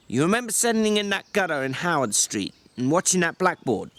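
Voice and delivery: a weary ranch hand berating his companion; George, exasperated. Acted. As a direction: snap at him like a fed-up older brother. George scolds Lenny.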